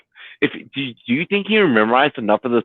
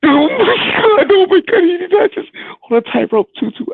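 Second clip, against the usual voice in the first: excited shout; 14 semitones higher than usual. Real, not acted. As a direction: shout it out in disbelief. Watching a wild play, excited.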